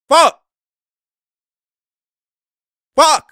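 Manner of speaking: sharp, high, loud outburst; frustrated curse. Real, not acted. Streamer swearing at a bad play on the broadcast. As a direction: blurt it out in real annoyance.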